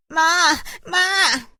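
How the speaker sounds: loud, high, drawn-out call; alarmed cry for mother. Acted. A girl calls her mother over lice, loud panicked cry. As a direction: wail for mum at full voice.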